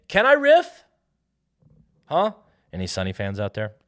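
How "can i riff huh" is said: exasperated outburst. Real, not acted. Defends his tangent, exasperated.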